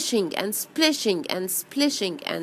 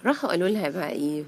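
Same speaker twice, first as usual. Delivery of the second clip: plain reading voice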